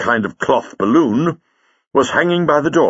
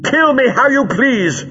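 high-pitched, raised-voice defiance; defiant shout; 14 semitones higher, 4 dB louder than usual. A narrator's reading. Captive woman dragged to the machine, defiant shout. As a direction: throw it in the captor's face. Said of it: "she shouted".

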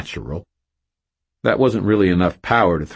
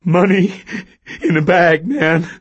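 high, loud panting plea; panting, near sobbing; 13 semitones higher, 7 dB louder than usual. A narrator's reading. Terrified cashier obeys robber, panting. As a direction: gasp it out near tears. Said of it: "Stan panted".

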